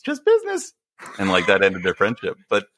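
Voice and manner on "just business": very high, airy falsetto impression; hammed-up high-pitched pop star voice. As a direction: squeak it out in a mock-innocent falsetto. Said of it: "he turns around to [name] and he goes".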